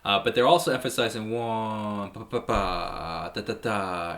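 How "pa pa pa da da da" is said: beat vocalized. Shown as a demonstration. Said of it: "they're hitting the eight, the and before each chord".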